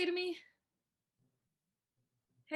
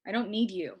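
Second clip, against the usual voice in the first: ordinary delivery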